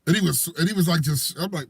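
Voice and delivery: ordinary talk, nothing marked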